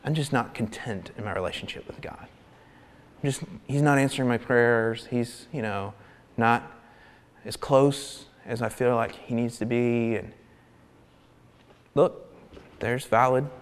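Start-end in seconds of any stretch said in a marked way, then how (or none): 0.0-10.2 low, soft, deflated complaint; mopey believer. Retold or imitated. Believer complaining about God, low and deflated. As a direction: sound tired and let down. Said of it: "we're like".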